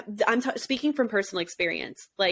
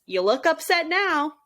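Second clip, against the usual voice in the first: taunting; 9 semitones higher, 3 dB louder than usual. Retold or imitated. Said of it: "Aaron responds and says".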